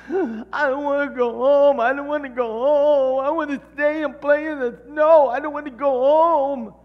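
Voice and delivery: put-on high, loud whiny child wail; mock-sobbing child begging to stay; crying child. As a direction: wail like a little kid leaving the snow. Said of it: "and I'm crying".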